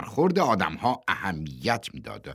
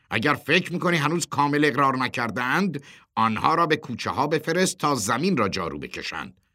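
higher, louder insistent voice; forceful demand; 7 semitones higher, 4 dB louder than usual. A narrator's reading. Boss tells chairman to send them sweeping, insistent. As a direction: press him with an order.

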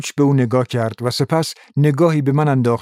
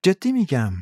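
politely; 5 semitones higher than usual. A narrator's reading. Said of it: "اسلیو مؤدبانه گفت".

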